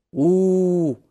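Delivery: menacing howl. Acted. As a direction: scare him with a drawn-out hoot. Genie threatens the boy with death. (угрожающее протяжное «у-у-у»)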